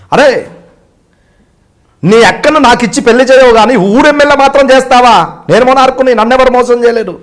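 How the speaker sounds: loud, high villain outburst; Prakash Raj. Retold or imitated. Self-declared monarch confronting a cheat, loud high outburst. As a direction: thunder it out as an outraged film tyrant. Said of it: "ఆ voice variation Prakash Raj గారు బాగుంటుంది".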